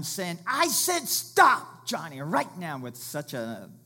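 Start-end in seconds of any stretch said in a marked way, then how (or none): 0.4-2.8 high, drawn-out shout easing into a firm order; forceful yell. Retold or imitated. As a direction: bellow it like a dad stopping his child. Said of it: "forceful voice".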